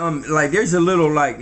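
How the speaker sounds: usual speaking voice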